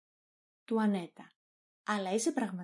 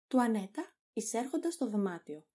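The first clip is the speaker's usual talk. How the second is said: ordinary talk, nothing marked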